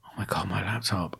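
breathless whispered realisation; a sudden hushed oh no moment; panicked whisper. Retold or imitated. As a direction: whisper it as the dread hits. Realising laptop left behind.

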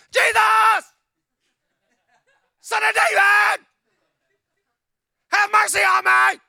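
high, loud, desperate cry for help; desperate shout. Acted. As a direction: scream his name across the crowd. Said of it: "started shouting".

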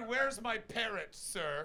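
slow, high West Country pirate growl; a pirate asking for his parrot. Shown as a demonstration. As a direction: drag it out like a pirate captain.

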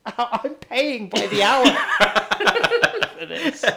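real laughter breaking through the words, slow; laughing. Real, not acted. Guest laughs recalling the ranting interviewer, laughing.

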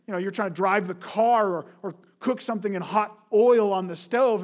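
ordinary delivery

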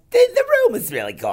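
put-on high fast old guy voice; Adam Sandler old guy voice; Tarantino impression. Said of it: "my Tarantino impression".

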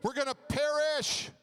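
hammed-up cry for help in a storm; panicked disciples.

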